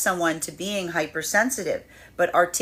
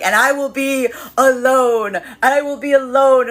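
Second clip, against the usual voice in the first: higher, louder, slow and grand, a mock dramatic vow; 5 semitones higher, 7 dB louder than usual. Energies vowing to each other to be alone, hammed-up and loud. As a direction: declare it like a stage vow, hamming it up. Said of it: "both of these energies being like".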